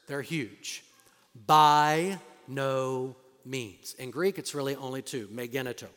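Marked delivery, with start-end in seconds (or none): 1.3-3.9 slow, low and louder by no means; emphatic denial. Shown as a demonstration. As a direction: drop it heavy and slow. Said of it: "Three little words. They're huge".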